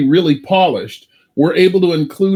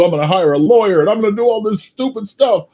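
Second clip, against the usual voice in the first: mock ranting; 5 semitones higher, faster than usual. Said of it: "all the things you're gonna do".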